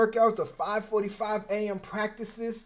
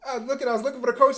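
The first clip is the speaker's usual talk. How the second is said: excited, rushed; 7 semitones higher, 5 dB louder, much faster than usual. Real, not acted. Excited retelling, laughing.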